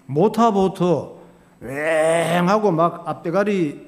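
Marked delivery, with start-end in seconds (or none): 1.6-2.5 drawn-out engine whine; motorboat noise imitation. Preacher showing the congregation how a motorboat takes off, drawn-out engine noise. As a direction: stretch out a mimicked motor buzz.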